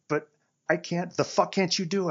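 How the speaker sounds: high, loud exasperated yell; angry shout. Acted. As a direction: blow up at him. Heated kitchen argument.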